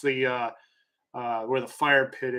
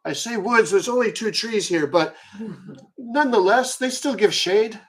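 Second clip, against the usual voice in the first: put-on higher-pitched merry old man, calling for wine; a tipsy elder waving newcomers to the fire; jovial old host; 6 semitones higher than usual. Old father welcomes new friends to the campfire, higher jolly old voice.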